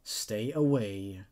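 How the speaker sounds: measured, grave storytelling read; creepy story narration. Acted. Narrator reading a creepypasta to viewers, measured and grave. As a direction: draw the listener into an eerie tale.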